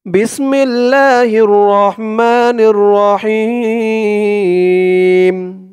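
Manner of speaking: slow chanted Arabic recitation; Quran recitation. Shown as a demonstration. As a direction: intone it slowly like tajweed. Recites bismillah.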